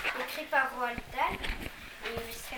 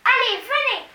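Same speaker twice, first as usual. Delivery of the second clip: high, loud hurry; urgent high girl's cry; 12 semitones higher, 13 dB louder than usual. A narrator's reading. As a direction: rush her friend along. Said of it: "s'écria-t-elle".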